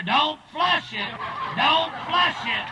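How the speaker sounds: high, loud and slow, laughing through it; drunk hollering. Acted. Drunk man in the swimming pool hollering up, loud laughing shout. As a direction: yell it up from the pool like it's a toilet. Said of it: "he'd holler".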